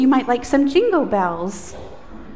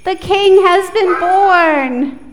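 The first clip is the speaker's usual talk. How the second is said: loud, high, slow proclamation; loud call-out; really loud; 6 semitones higher, 7 dB louder, slower than usual. Shown as a demonstration. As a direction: proclaim it for them to echo. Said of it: "repeat after me".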